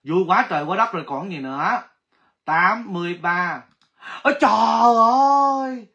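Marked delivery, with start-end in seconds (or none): 4.2-5.9 loud surprised oh my god; loud delighted gasp of words; excited exclamation. Real, not acted. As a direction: burst out at the winner. Winner found, exclaiming.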